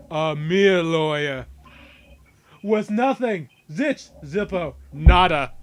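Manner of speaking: pompous, booming tycoon sneer; haughty villain voice. Acted. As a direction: lord it over a lowly lawyer.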